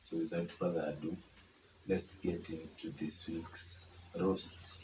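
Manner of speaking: ordinary talk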